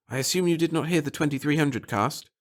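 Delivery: a hint of a confident conspirator; lightly voiced, sure of himself; lightly voiced Pettigill.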